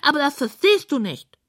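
high squeaky pig voice; huffy pig. A narrator's reading. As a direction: defend yourself in a high squeal. Pig defends short poem.

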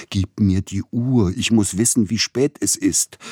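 loud, high, insistent pleading; urgent demand. A narrator's reading. Feverish patient demands his watch, loud and urgent.

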